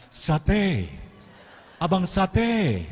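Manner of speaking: drawn-out high, loud call for the satay seller; weak chest-voice street call. Shown as a demonstration. Speaker demonstrates calling the satay seller in chest voice. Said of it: "pakai lagi suara dada".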